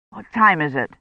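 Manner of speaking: usual speaking voice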